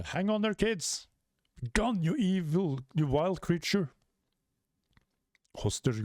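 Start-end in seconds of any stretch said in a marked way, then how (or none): 0.0-3.9 higher, urgent rescue call; high heroic giant voice; heroic shout. Acted. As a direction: call out to the kids bravely.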